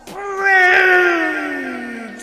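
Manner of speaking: huge high bellow on one long word. A narrator's reading. Missus Grumblebaum answers Algernon across the sky, bellowing. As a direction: bellow it out, holding the vowel. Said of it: "bellowed Missus Grumblebaum".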